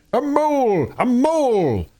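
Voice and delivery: high, ringing battle shout; war cry. Acted. Mole charging the weasels, shouting his war cry. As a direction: bellow it as you charge. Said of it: "shouting his awful war cry".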